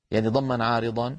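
normal talking voice

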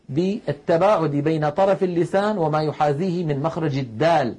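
ordinary talk

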